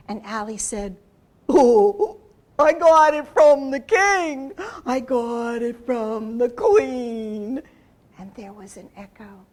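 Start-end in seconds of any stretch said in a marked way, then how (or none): 1.5-7.6 alligator voice. Acted. Alligator voice in story.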